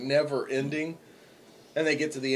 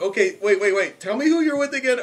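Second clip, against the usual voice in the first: laughing cop; 8 semitones higher, 6 dB louder than usual. Retold or imitated. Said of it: "dying laughing".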